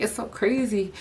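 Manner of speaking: usual speaking voice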